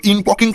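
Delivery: ইনপকিং, with "poking" for "portant"; mispronounced "important". Acted. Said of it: "ইম্পর্টেন্ট, ইম্পর্টেন্ট". (ইনপকিং বলে ভুল উচ্চারণ)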